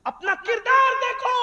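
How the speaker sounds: high, drawn-up cry; ringing rebuke. Real, not acted. Preacher telling listeners to examine themselves, ringing rebuke. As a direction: throw the command high over the crowd.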